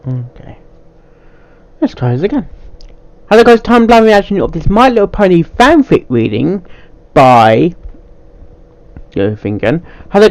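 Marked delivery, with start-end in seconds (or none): 3.3-6.7 higher, brighter YouTube greeting; cheery channel intro; upbeat intro voice. Acted. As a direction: sell the opening like a host. Recording video intro takes.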